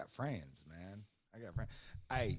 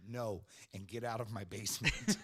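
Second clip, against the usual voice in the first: mildly raised mock-angry voice; all-caps retort; 3 semitones higher, 7 dB louder than usual. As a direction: play an all-caps text out loud, laughing. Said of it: "texts me in all caps".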